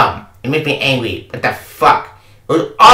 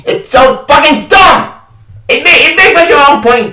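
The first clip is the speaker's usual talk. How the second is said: angry rant; 9 semitones higher than usual. Real, not acted. Fed-up player ranting about the dumb ending, angry shout. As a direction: spit the words out in disgust.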